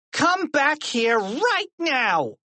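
slow, high-pitched commanding yell; angry yell. A narrator's reading. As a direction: yell the order, stretching every word. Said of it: "she yelled".

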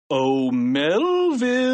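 slow singsong summons, rising high; long, lilting devilish call. A narrator's reading. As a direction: call sweetly and slyly, stretching the name. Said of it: "he called".

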